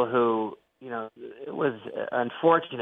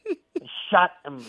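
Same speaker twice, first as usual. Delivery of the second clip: slow, higher George Burns drawl on the punchline; George Burns impression; 7 semitones higher, slower than usual. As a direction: deliver it slow like old George Burns with a cigar. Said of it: "like George Burns".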